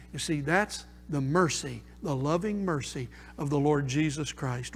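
low, subdued preaching, fading towards a prayerful pause; hushed preaching. Real, not acted. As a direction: bring the congregation down into quiet reflection. Preacher drops into hushed, reflective exhortation.

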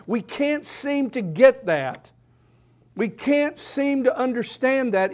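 impassioned sermon climax; fervent preaching. Acted. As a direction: drive the point home to the church. Preaching at full force.

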